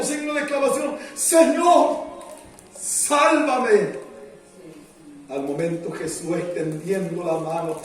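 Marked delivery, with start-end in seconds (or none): 1.1-4.1 high, loud plea for rescue; crying out. A narrator's reading. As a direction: call out for help in two bursts. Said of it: "dio voces diciendo". (clamor a voces pidiendo auxilio)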